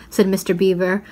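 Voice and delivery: plain reading voice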